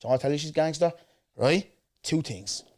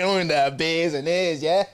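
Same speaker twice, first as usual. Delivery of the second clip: cocky boast; 6 semitones higher, 5 dB louder than usual. Retold or imitated. Boasting to her in retelling.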